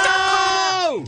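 shrill high "No!"; high shout. Acted. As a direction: yell it way up high. Reply to catchphrase.

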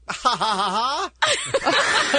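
high, drawn-out fake laugh; mock laugh. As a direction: imitate her laughing it off. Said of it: "she's like".

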